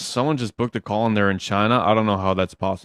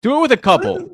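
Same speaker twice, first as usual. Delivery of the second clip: high, louder insistent push; urging coaxing; 10 semitones higher, 4 dB louder than usual. Real, not acted. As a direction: egg her on eagerly. Coach urging caller.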